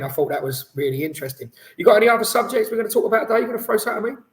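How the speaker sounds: usual speaking voice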